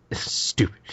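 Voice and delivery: imitated whispered grumble; grumbled whisper. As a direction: say it under your breath. Repeated grumble under the breath.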